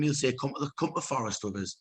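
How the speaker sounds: normal talking voice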